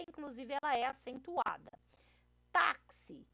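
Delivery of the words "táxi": "Táxi" higher and louder on "Tá"; stress on first syllable. Shown as a demonstration. Teacher gives a paroxítona example, "Táxi" stressed hard on "Tá". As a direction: punch the "Tá" of "Táxi". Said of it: "também a segunda sílaba".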